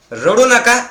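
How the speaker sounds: high, loud रडू नका; loud preaching command; emphatic command. Acted. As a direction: order them to stop crying.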